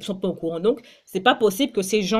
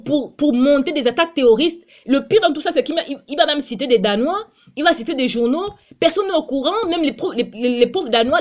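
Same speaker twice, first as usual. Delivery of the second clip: rising indignation; 5 semitones higher, 5 dB louder than usual. Real, not acted. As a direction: pitch climbing higher and louder into outrage. Rising outrage at the president's plot. (indignation montante, de plus en plus haute et forte)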